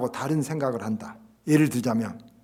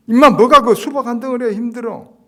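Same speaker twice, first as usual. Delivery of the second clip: loud, much higher scolding; mock the complainer loudly; scornful rebuke; 9 semitones higher, 15 dB louder than usual. Scolding reply replayed.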